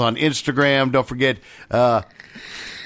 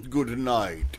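Russian accent; 2 semitones lower, 8 dB quieter than usual. Shown as a demonstration. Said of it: "Do it in the Russian accent".

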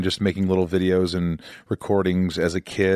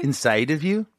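higher-pitched imitation Scottish, rising like a question; Scottish attempt; 6 semitones higher than usual. Host mimicking guest's phrase, attempted Scottish accent. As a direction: try copying a Scottish lilt. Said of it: "with your Scottish accent".

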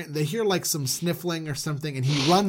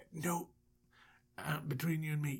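hushed, hurried aside; very soft, confiding undertone; confidential whisper; 14 dB quieter than usual. Acted. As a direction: drop to a secretive whisper. Confiding in guard about boss.

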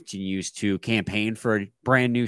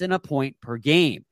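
normal talking voice

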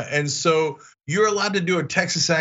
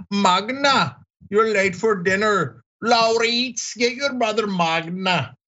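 higher-pitched mock Norwegian accent; put-on Norwegian accent, pitched up; 6 semitones higher, slower than usual. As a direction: imitate Norwegians calling family names. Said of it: "laugh at people's accents".

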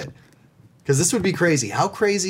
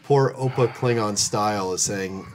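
usual speaking voice

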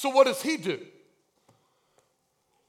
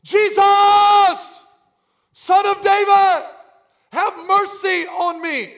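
high, full-voiced shouted plea; loud cry for mercy; 9 semitones higher, 10 dB louder than usual. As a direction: holler it out with held vowels. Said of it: "we should never cry out".

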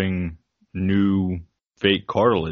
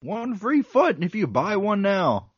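home shopping host; 11 semitones higher than usual. Pretend. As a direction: sell it like a TV pitchman. Parody TV sales pitch.

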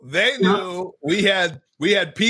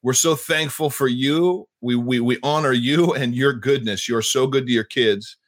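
steady, reverent prayer that sinks lower toward the amen; earnest prayer; 5 semitones lower than usual. Acted. Host praying for guest heading to the Middle East, reverent prayer. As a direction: pray over him, calm and sincere.

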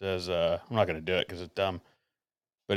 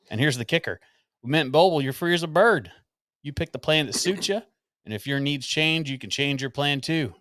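put-on rustic Appalachian accent. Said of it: "in a rustic Appalachian accent".

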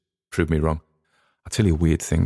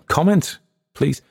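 high rising plea; 9 semitones higher than usual. Real, not acted. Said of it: "call to action, repeated word".

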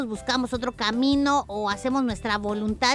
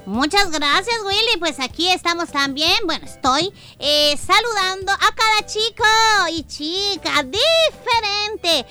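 put-on loud, higher children's host voice; bright squeaky sing-song welcome; 6 semitones higher, 5 dB louder than usual. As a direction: greet every kid with big cheer.

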